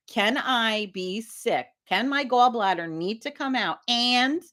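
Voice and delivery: drawn-out question in measured pieces; deliberate, stretched-out questioning; slow, spelled-out asking. Retold or imitated. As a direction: lay it out slowly, piece by piece. Retold slow questioning of doctor.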